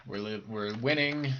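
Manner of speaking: usual speaking voice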